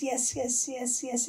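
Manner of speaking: high-pitched happy chain of yeses; high and delighted; overjoyed woodcutter. Acted. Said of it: "the woodcutter is so happy".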